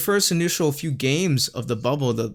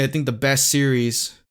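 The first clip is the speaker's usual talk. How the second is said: ordinary delivery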